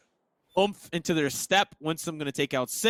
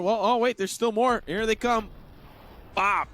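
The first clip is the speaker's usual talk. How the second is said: excited; 7 semitones higher than usual. Real, not acted. Replay builds to the kill.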